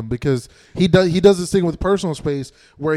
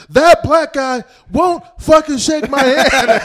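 loud, high, drawn-out mock outrage; retold yelling; 8 semitones higher, 6 dB louder, slower than usual. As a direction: imitate him complaining at full volume. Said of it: "he was, like, yelling it".

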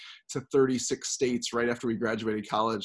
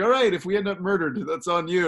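louder, higher dark warning; a dry threat, raised and pointed; wife's mock warning; 6 semitones higher, 5 dB louder than usual. Wife warning about staying with a stranger, mock grim. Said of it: "she's like".

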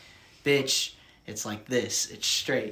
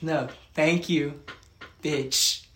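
slow, louder, spelled-out correction; correcting teacher; 4 semitones higher, 7 dB louder, much slower than usual. Retold or imitated. Teacher corrects the student's sign, pointed and slow. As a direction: lay out the difference pointedly. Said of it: "She's like".

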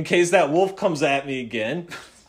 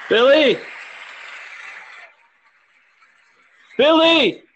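calling out; 10 semitones higher, slower than usual. Acted. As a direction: call across the street. Calling out for missing Billy.

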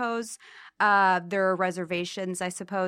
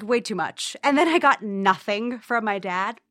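ordinary talk, nothing marked

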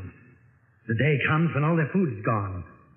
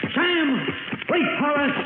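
calling out; 12 semitones higher than usual. Acted. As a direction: shout his name into the dark cave. Calling after the fleeing guide.

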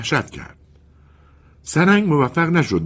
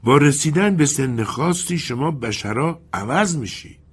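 slow, higher and measured; slowly; 7 semitones higher than usual. Acted. Bernardi tells the colonel slowly how humans change. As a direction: take your time on every word. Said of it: "برناردی آهسته گفت".

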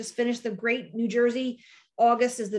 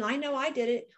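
ordinary talk

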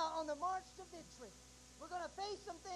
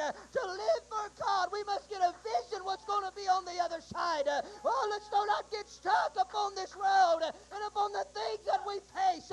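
full-force preaching; 3 semitones higher, 9 dB louder than usual. Acted. Preacher urging the congregation to hang on, shouting. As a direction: lift the congregation with hope, crying out.

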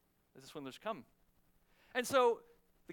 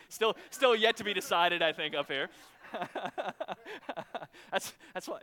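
laughing; 5 dB louder than usual. Real, not acted. Laughing at own marriage joke.

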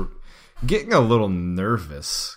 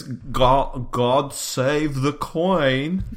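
slow, higher, hammed-up British; mock British; 5 semitones higher, much slower than usual. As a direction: intone it like a loyal subject. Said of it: "all you have to say is".

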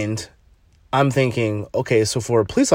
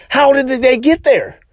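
much higher, louder indignant question; exasperated disbelief; 14 semitones higher, 5 dB louder than usual. Real, not acted. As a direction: demand it in disbelief. Indignant rant about racist cops.

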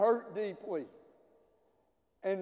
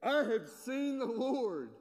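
higher, bright proclaiming of the news; joyful announcement; 5 semitones higher than usual. Retold or imitated. Mary bursting in on the disciples, joyful. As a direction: announce it like you can't believe it. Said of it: "Mary Magdalene went to the disciples with the news".

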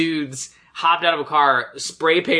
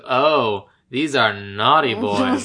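low, slow, relishing each word; gleeful Santa voice; 7 semitones lower, much slower than usual. Retold or imitated. Santa delighted to find naughty boys, low slow relish. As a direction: savor it like Santa found his prey. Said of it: "the way he said that".

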